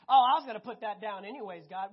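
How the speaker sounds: a loud, hammed-up excuse to God; mock excuse. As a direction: loudly fake it's no big deal.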